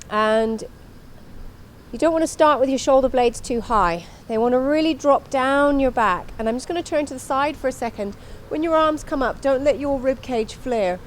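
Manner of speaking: ordinary delivery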